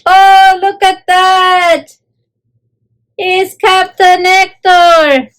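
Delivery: high, slow, drawn-out admiring coo; stretched-out, high-pitched admiration; gushing admiration. Real, not acted. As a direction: gush over how handsome he looks. Admiring Hector dressed up as captain.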